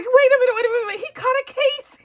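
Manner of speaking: retelling her own shocked delight at the joke; excited realization. Retold or imitated. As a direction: squeal of sudden understanding. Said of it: "I was like".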